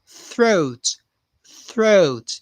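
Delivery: "throat" said carefully, tongue between the teeth for the th; "throat" with a clear th and a long o; pronunciation demo. Shown as a demonstration. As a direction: model "throat" slowly and clearly for learners. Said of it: "Pay attention to the pronunciation here".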